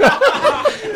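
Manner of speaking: high, giddy laugh; real high-pitched laugh; burst of laughter. Real, not acted. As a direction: crack up at your own joke. Cracks up at own dark joke.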